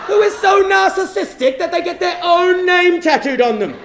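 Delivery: high, loud self-mocking rant; appalled comic yelling; incredulous outrage. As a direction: shout in disbelief at your own stupidity. Appalled at his own tattoo.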